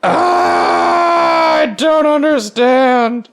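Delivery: high, loud, drawn-out yell; exasperated shout. Real, not acted. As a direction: cry it out loudly in confused frustration. Outburst at a confusing puzzle.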